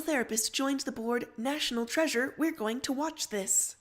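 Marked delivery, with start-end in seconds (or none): none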